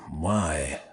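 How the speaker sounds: low, honeyed Deep South purr; languid Southern drawl. A narrator's reading. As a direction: purr it like a Southern plantation owner. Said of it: "languid southern drawl of a plantation owner".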